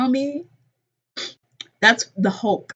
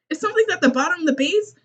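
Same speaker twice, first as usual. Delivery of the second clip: plain reading voice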